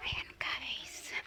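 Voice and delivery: hushed whisper to viewers. Real, not acted. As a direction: breathe it out unvoiced. Aside to viewers.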